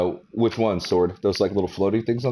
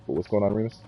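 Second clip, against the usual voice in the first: muffled, as if the mic is covered; 3 dB quieter, faster than usual. Real, not acted. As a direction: voice through a cloth over the mic. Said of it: "like you had a little sheet of something over your microphone".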